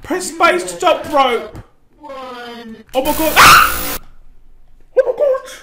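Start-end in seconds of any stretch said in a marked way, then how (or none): 2.0-5.5 much higher, fast shocked cry; shocked squeal. Real, not acted. Player caught off guard, high fast shocked cry. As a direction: shriek in surprise.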